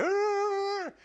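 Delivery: high, drawn-out mock bark; imitated bark. Teammate imitating a forward barking on the forecheck, high mock bark. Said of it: "he's barking at people".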